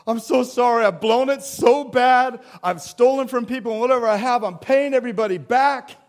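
loud, high desperate confession; pleading Zacchaeus. Acted. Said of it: "Zacchaeus just says".

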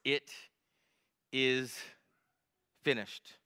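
ordinary delivery

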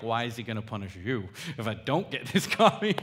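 loud, laughing through the words; laughing mock complaint. Retelling the coffee wait to the congregation, laughing. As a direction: tease the petty complaint while laughing. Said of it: "More like".

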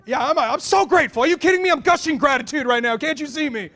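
high, very loud mock outburst; over-dramatized indignation. Wife's imagined reply to the rebuke, dramatized indignation. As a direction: ham up the wounded protest at full volume. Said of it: "a little bit more dramatized".

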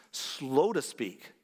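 "slow to speak" drawn out very slowly; deliberately slow. Shown as a demonstration. Pastor showing the right way to the church, very slowly. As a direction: stretch "slow to speak" as if living it. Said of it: "James says we must be".

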